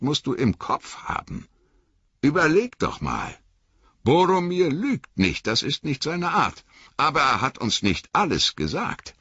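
earnest hobbit reasoning with himself; self-talk that sinks into hurt; Sam thinking aloud. Acted.

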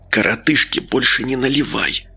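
hushed confidential aside, only half whispered. A narrator's reading. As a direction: slip it to a neighbour under your breath. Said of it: "шепнул Медовар Вениамину". (полушёпотом, по секрету)

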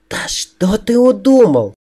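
high, drawn-out nervous outcry; nervous cracking voice. A narrator's reading. She challenges her disguising friend, nervously, voice pitched high. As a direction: let nerves send the voice way up. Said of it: "У неё на нервах срезался голос".

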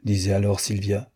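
ordinary delivery, nothing marked

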